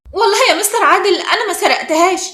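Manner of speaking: wronged pupil's protest; protesting innocence. A narrator's reading.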